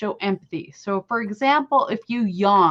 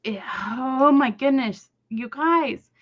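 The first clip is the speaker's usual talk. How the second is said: usual speaking voice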